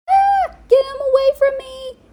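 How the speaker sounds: high, loud scared cry; 2D fleeing Murdoch in panic; panicked yelp. Acted. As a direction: shriek it while fleeing. Running from Murdoch.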